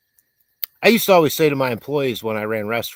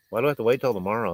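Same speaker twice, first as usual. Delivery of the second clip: ordinary delivery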